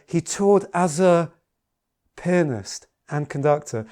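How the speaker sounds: slow down, then pause before "pianist"; drawn-out lead-in and a gap before "pianist"; suspenseful emphasis. Shown as a demonstration. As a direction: hold back in suspense before landing on "pianist".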